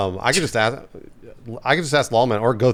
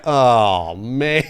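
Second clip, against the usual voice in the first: ordinary delivery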